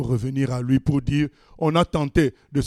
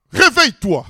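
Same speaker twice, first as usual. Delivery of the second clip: commanding; 8 semitones higher, 4 dB louder than usual. Acted. Jesus ordering the body.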